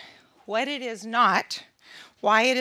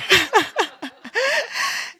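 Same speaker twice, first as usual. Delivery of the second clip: a real, high laugh at herself; bright rising laugh of amusement; high burst of laughter; 12 semitones higher, 4 dB louder than usual. Real, not acted. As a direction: laugh out loud at the memory. Laughing at her own story.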